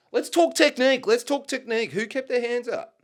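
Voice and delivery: high-pitched, jokey protest; mock challenge. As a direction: pipe up high in playful self-defence. Mock challenge after losing punch contest.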